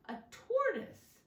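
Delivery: put-on spring the surprise on the kids; surprised reveal. Reveal to the children.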